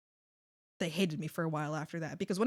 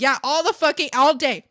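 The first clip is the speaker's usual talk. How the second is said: loud, emphatic complaint; re-enacted fed-up outburst; exasperated retort; 5 semitones higher, 9 dB louder than usual. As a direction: retell snapping back at a coworker. Retelling telling off a whiny coworker.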